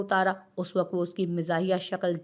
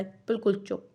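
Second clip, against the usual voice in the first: ordinary delivery, nothing marked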